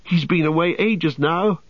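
high, fretful complaint; high young hobbit voice; worried hobbit. A narrator's reading. Pippin still crying out in worry.

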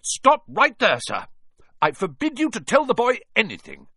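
loud, high, blustering order; commanding. A narrator's reading. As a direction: forbid it at full voice. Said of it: "he commanded".

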